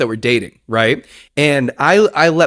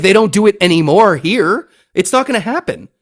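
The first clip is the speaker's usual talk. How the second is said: higher-pitched, insistent retold scolding; firm refusal; 6 semitones higher than usual. Teacher forbids classroom making out, firm and exasperated. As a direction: put your foot down.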